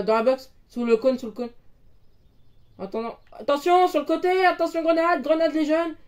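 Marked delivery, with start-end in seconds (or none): 3.3-5.9 high urgent callout; grenade warning yell. Real, not acted. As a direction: shout the warning to the squad. Warning teammates mid-fight.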